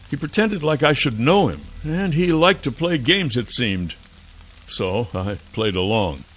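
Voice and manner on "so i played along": plain reading voice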